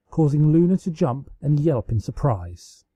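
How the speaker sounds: plain reading voice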